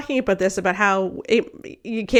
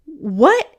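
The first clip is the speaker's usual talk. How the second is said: ordinary delivery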